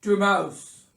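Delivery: loud, formal bear voice; Bear announcing. A narrator's reading. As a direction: proclaim the heir. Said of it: "announced Bear".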